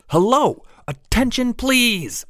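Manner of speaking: high calling-out shout. Acted. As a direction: call out over a crowd. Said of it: "one young woman shouting".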